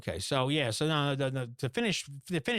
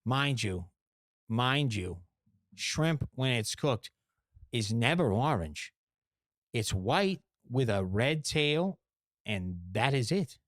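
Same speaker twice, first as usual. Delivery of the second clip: slow, deliberate lecturing; emphatic, slow correction of orange shrimp; slow lecture; slower than usual. Real, not acted. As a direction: spell it out slowly for the listeners. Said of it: "lecturing slowly on shrimp".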